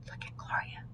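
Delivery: put-on hushed whisper among kids. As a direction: whisper so the officer won't hear. Children whispering about dog.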